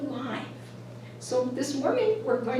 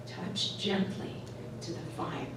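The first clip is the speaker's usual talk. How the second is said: soft slow whispered demo of the line; 4 semitones lower, 8 dB quieter than usual. Shown as a demonstration. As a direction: whisper it slowly and softly. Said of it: "And the final line is".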